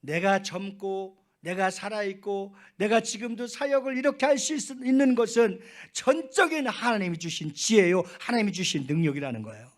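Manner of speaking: loud, high build that drops at the close; impassioned preaching. Real, not acted. Pastor declaring God gave wisdom and strength, impassioned preaching. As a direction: drive the point home from the pulpit, then settle.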